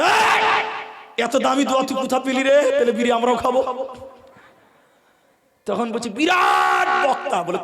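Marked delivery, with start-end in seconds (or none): 0.0-3.6 high shouted hey, then quick high words; mock amazed exclamation. Preacher imitating a second boy amazed by the precious prayer, excited shout. 6.2-7.4 high, stretched-out shouted first word; mock grand announcement. Preacher retelling his reply about the great speaker, mock-grand shout. Mock praise of the boy's source.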